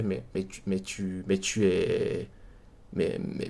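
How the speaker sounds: replayed dumbfounded stammer, very low and hushed; stunned muttering. Viewer slowly realising the obvious to himself, stunned muttering. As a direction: trail through false starts under your breath. Retold dawning disbelief at the skeptic.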